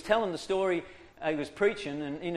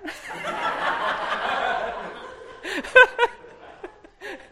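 long breathy high laughter; 3 dB louder than usual. Real, not acted. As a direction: laugh at your own pride. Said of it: "long laugh after confession".

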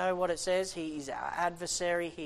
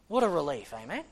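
ordinary talk, nothing marked

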